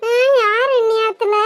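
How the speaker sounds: ordinary delivery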